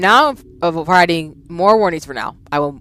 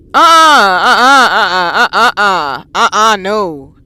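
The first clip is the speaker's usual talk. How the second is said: drawn-out frightened uh-uh; whimpering no; scared refusal; 6 dB louder, slower than usual. Real, not acted.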